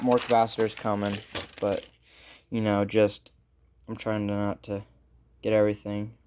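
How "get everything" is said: ordinary talk, nothing marked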